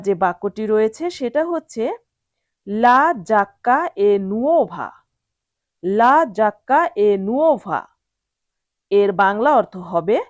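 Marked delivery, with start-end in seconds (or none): 2.7-7.8 slow, careful Italian sentence for learners; slow teacher model of Italian. Shown as a demonstration. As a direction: spell it out word by word, slowly. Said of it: "teacher models Italian sentence".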